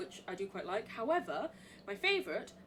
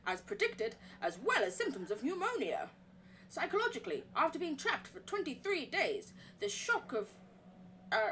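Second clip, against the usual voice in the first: document read-aloud; 3 semitones higher than usual. Acted. Reading the sanatorium report in a put-on American accent.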